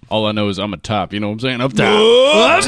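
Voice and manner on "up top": high, loud up top cheer; excited call. Real, not acted. Calls for a high five.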